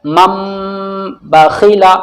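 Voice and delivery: "मम" with a long nasal hum on the final m; ghunna demonstration. Shown as a demonstration. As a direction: hold the m of "मम", then say "बखीला". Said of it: "गुन्ने के साथ". ("मम" का मीम गुन्ने के साथ खींचकर)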